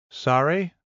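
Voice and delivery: a mildly baffled query; slightly confused. Acted. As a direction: ask it as if you've lost the thread. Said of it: "slightly confused".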